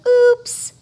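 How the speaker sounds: loud high oops. Acted. Froggy falling off his chair, loud oops. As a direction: caught falling over.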